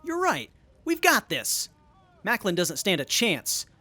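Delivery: high, loud burst of pumped-up confidence; excited. Acted. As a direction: shout it like you finally believe you'll win. Rallying confidence before battle.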